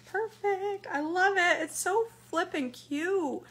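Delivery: excited. Real, not acted. Delighted by the finished wrap.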